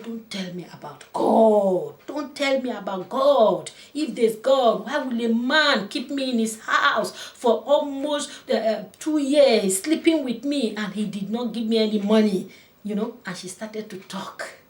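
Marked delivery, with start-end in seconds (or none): 0.0-1.9 slow, louder indignant refusal; retold angry woman, first take; slow angry retort. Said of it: "She said". 2.1-3.7 higher, louder, slow repeated refusal; retold angry woman, repeated higher; higher angry retort. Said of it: "She said". 3.9-12.5 higher, louder grievance; retold angry woman venting; bitter angry complaint. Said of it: "She said".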